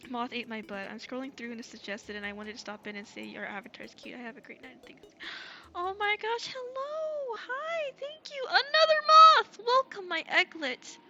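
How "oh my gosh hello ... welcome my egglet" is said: excited. Real, not acted. Squealing welcome to new viewer.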